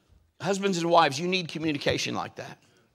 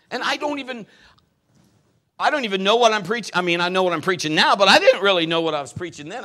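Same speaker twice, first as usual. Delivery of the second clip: louder, faster preacher's build; preaching with fire; 7 semitones higher, 8 dB louder than usual. Acted. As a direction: drive it home to the church. Preaching builds again, loud.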